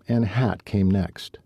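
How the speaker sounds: usual speaking voice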